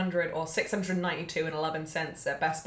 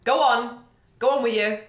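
louder stern teacher ordering; 3 semitones higher, 6 dB louder than usual. Retold or imitated. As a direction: send the boy to the corner. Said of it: "they'll be like".

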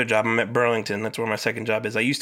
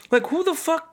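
very high-pitched disbelief; incredulous outburst. Real, not acted. Ranting about police sending a dog over, incredulous outburst. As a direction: shoot the pitch way up, baffled.

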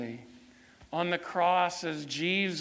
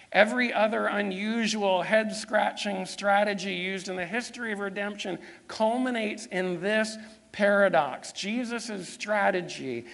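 higher, driving sermon delivery; forceful preaching; 4 semitones higher, 4 dB louder than usual. Real, not acted. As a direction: preach it with rising conviction.

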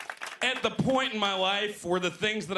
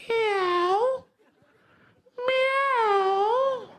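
much higher, loud mewing cat; cat meow; 5 dB louder than usual. Retold or imitated. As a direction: mew like a cat on the subway. Cat heard on the subway.

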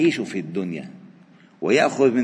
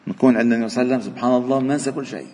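rapid retelling; much faster than usual. Real, not acted. Fast excited retelling.

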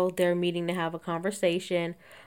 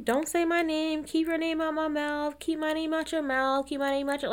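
high, droning mock repetition on one note; insistent high-pitched monotone chant, mocking; Portia; 8 semitones higher, 3 dB quieter than usual. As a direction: imitate someone talking over others on a loop. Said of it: "say the same thing over and over".

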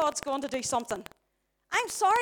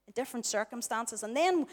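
normal talking voice

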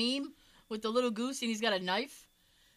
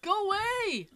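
high, stretched-out shooing; honking creature voice; 10 semitones higher, slower than usual. Retold or imitated. Goose telling a kid to leave, high squawky shooing.

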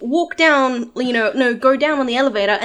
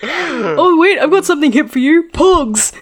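put-on higher, louder, fast old man voice; eager old man offering Pogs; 5 semitones higher, 6 dB louder than usual.

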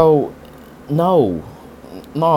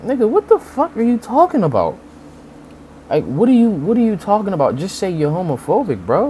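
exasperated disbelief; 10 semitones higher than usual. Retold or imitated. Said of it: "And it's like".

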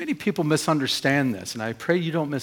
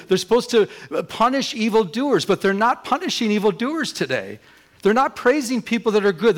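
fiery preaching; 6 semitones higher, 3 dB louder than usual. Acted. Preaching at full force.

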